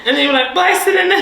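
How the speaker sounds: high, loud, giggly telling; real laughing retelling. Real, not acted. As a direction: crack up while retelling it. Retelling a hilarious mishap, cracking up.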